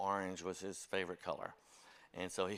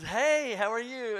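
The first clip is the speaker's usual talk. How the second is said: high, beaming shopkeeper greeting, much higher and louder; overly cheerful clerk; 12 semitones higher, 8 dB louder, slower than usual. Retold or imitated. Liquor store clerk greeting the church staffer, beaming and high. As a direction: sing it out like a delighted salesman. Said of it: "he puts on this big smile".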